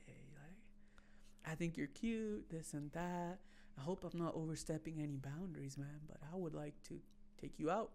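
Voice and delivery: mild shy mumble. Retold or imitated. Voicing shy guy asking out.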